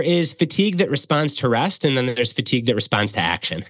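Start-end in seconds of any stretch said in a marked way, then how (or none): none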